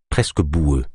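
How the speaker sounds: ordinary delivery, nothing marked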